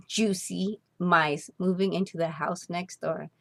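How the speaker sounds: plain reading voice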